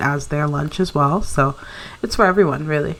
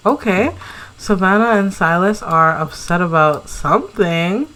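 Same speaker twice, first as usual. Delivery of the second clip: normal talking voice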